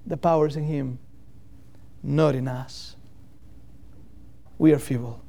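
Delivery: ordinary talk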